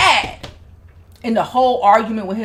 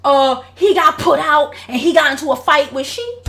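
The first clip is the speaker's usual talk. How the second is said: higher, raised dad voice; voicing the dad; 7 semitones higher, 4 dB louder than usual. Father confronting his wife about her son, raised voice. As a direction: plead the dad's case with heat. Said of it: "He was like".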